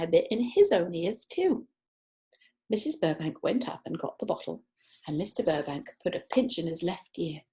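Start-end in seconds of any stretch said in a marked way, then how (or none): none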